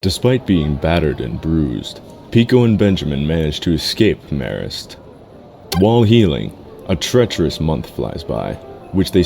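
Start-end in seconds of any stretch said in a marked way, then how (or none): none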